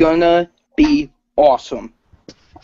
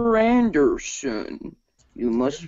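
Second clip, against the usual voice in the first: drawn-out villain impression; Agent Smith; slower than usual. Agent confronting Mister Anderson, slow drawn-out villain voice. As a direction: stretch the name out very slowly. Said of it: "impression of Agent Smith from The Matrix".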